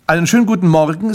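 high, loud, brisk doorstep greeting; chirpy salesman greeting. Acted. Grimpel greets the man at the door, loud salesman pitch. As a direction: greet like an eager little salesman.

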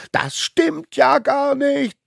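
very high, loud outraged yell; bellowing. A narrator's reading. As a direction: burst out in a childish roar of protest. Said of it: "brüllte er". (empört gebrüllt, hoch und laut)

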